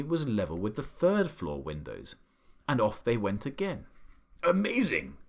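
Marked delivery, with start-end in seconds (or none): 4.4-5.2 cried out. A narrator's reading. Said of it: "cried the Duke".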